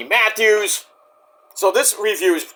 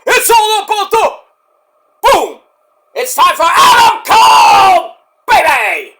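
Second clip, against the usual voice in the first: a much higher, louder, slow hype yell; full-voiced hyped chant, much louder; catchphrase shout; 14 semitones higher, 9 dB louder, slower than usual. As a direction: belt out the catchphrase like the crowd. Chanting Adam Cole's entrance catchphrase.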